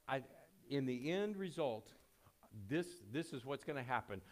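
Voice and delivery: ordinary talk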